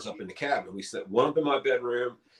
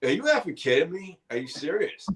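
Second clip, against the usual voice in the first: higher, mock outraged disbelief; retold disbelief; 7 semitones higher than usual. Seeing the remote cabin, mock outraged are you kidding me. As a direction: imitate your own stunned reaction. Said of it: "I'm like".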